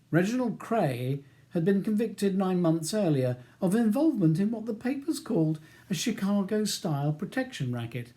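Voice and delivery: ordinary delivery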